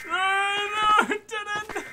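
high cracking scream of panic; shriek, cornered; terrified shriek. Real, not acted. Trapped by monster.

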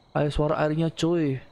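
ordinary talk

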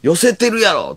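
high, loud teasing jab; playful tease. Retold or imitated. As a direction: tease her gleefully. Teasing Arii.

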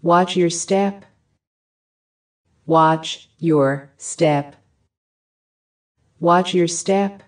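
usual speaking voice

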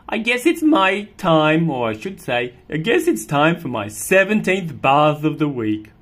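loud, high fast demand at the end; a put-upon cat at bath time; fussy cat. Acted.

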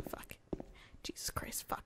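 breathy whispered swearing; whispered curse. Real, not acted. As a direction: hiss the curse under breath. Frustrated at stairs.

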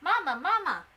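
loud, high puppy call. A narrator's reading. Puppies run to their mother, calling out loudly. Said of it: "they called".

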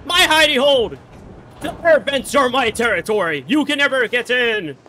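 loud, fast gloating yells; excited shouting. Real, not acted. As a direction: crow from your hiding spot. Hiding in vents, gloating.

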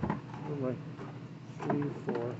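usual speaking voice

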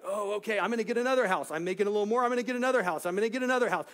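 loud, high, over-eager chatter; hammed-up excitement, pitched high; mock eager buyer. Mimics an eager house upgrader.

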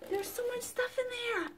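high, hushed squeal of excitement; thrilled, high-pitched and soft; excited squeal. Real, not acted. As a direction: squeal softly at a box full of goodies. Excited peek inside the box.

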